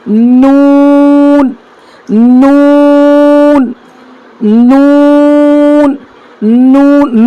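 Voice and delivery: نون held very long; slow model of نون; drawn-out letter demo. Shown as a demonstration. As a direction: stretch each نون slowly. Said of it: "اگلا حرف کیا ہے".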